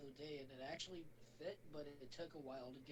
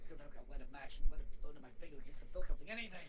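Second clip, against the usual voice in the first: brooding movie quote; 14 dB louder, faster than usual. Retold or imitated. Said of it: "My favorite line is when he's like".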